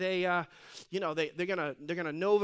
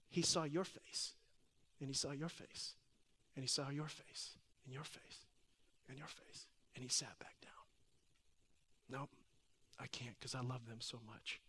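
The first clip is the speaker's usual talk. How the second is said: low, fading almost to a whisper; hushed and tender; 6 semitones lower, 15 dB quieter than usual. Retold or imitated. Preacher telling congregation why God sat back down, hushed. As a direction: let each phrase sink softer, with feeling.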